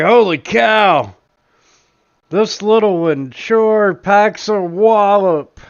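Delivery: high, loud holy cow shout; loud startled drunk. Acted. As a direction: bellow it, stunned by the punch. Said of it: "he's like".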